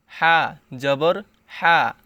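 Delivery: হা breathed from mid-throat, slow; throaty ha. Shown as a demonstration. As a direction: push হা from the middle of the throat. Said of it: "হাকে গলার ভিতরে নিয়ে পড়তে হবে".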